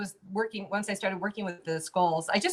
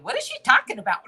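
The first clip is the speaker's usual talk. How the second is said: high, louder, puzzled mock voice of her own doubting mind; mocking inner monkey brain; 7 semitones higher, 8 dB louder, faster than usual. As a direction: play the skeptical inner voice, pitched up. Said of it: "my monkey brain is in the side going".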